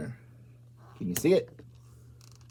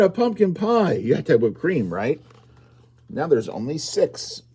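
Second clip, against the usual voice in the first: plain reading voice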